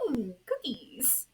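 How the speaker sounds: slow, high ooh of delight. Acted. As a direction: savour the word cookies. Ghost tempted by cookies.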